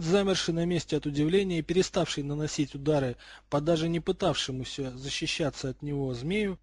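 ordinary delivery

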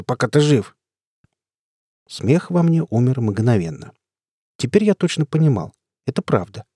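ordinary delivery, nothing marked

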